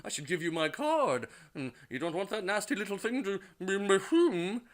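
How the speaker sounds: high, loud warbling woman's voice; Julia Child. Acted. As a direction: play the strange clairvoyant in a shrill, hammed-up voice. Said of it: "Julia Child's voice".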